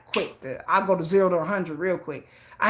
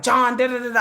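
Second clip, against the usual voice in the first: mock nagging, pitched up; retold fussing; 6 semitones higher, 4 dB louder than usual. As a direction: imitate a wife fussing at her husband. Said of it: "I was fussing at him".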